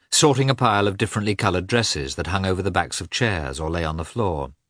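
plain reading voice

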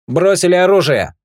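high, loud order to drop weapons; shouted command. Acted. Partner behind the trio orders them to disarm, shouting. Rick demands weapons dropped.

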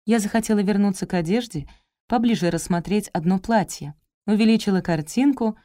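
plain reading voice

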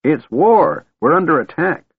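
high-pitched, gleeful alarm; gleeful shout. A narrator's reading. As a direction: raise the alarm with delight. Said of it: "he shouted gleefully".

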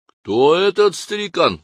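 high, slow soldier's question; a young guard wondering aloud; curious soldier. Acted. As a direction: ask it as a puzzled young guard. Soldier asks about old man.

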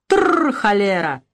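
медленно, хрипло осаживая коня; слегка изображённый мужик; простуженный голос. Acted. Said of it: "простуженный голос Евдокима". (отец во дворе осаживает лошадь, простуженно)

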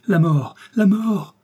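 loud, high cries of terror; terrified scream. Acted. Both men see glowing skulls, terrified scream. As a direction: scream it in the dark. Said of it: "hurlèrent en même temps".

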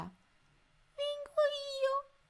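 very high, small squeaky voice; tiny mouse voice. A narrator's reading. Little mouse volunteering for the mission, tiny squeak. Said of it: "si udì una vocina".

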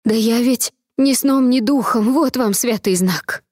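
high, slow, flustered babble; babbling frightened maid. Acted. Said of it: "Залепетала".

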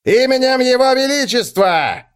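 high, loud official call; loud command. A narrator's reading. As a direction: proclaim the arrest. Guard's command continues.

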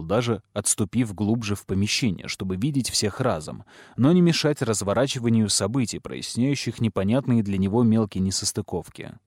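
plain reading voice

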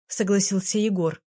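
usual speaking voice

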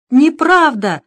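indignant scream; shouting. A narrator's reading. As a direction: yell it back at him. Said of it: "закричал Кагановский".

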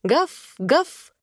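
high, clear puppy barks; small child's high-pitched "woof"; ringing bark. A narrator's reading. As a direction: bark the word like a gleeful little boy playing dog. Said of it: "заливался звонким, чётким лаем".

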